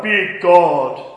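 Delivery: booming, slow and loud; mock deep voice. Shown as a demonstration. Preacher wanting a big reverberant God, loud mock boom. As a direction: boom it out hugely, drawn out. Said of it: "I wish I had a deep voice".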